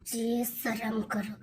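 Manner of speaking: low, soft, slow "जी शर्म करो" under the breath; wife hushing her husband's crude talk, low and slow; hushed scold. Acted. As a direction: shame him quietly, drawn out.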